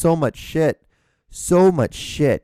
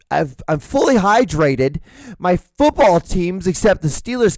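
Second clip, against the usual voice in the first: ordinary delivery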